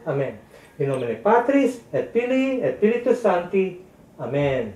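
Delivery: steady devout recited prayer; prayer recitation. Real, not acted. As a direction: pray it aloud, evenly and devoutly. (taimtim na pagdarasal)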